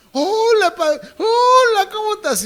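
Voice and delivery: very high, hammed-up falsetto hello; mock a two-faced person greeting sweetly; high, fake-friendly hypocrite voice; fake sugary greeting.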